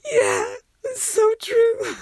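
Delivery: hammed-up sob of a mourner; mock tearful agreement. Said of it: "the wee woman at the front was just going".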